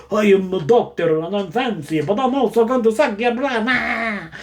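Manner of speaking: hammed-up nineties vampire voice; campy vampire. Morbius introducing himself as doctor and vampire, campy vampire. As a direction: play a silly fancy bloodsucker, rising to an "ah". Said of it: "He's like a vampire".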